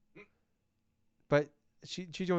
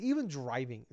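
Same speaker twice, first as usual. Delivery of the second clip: normal talking voice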